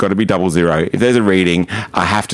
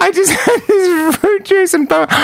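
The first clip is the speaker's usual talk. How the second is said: high, louder mock sobbing plea; imitating crying; 3 dB louder than usual. Retelling a friend pleading with the cop, in mock tears. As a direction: whine it through fake tears. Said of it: "She started to cry".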